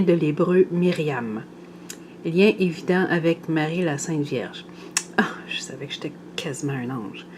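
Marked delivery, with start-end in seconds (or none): 5.2-7.3 quick amused whisper under the breath; whispered aside. Real, not acted. Whispering a joke about herself to the viewers.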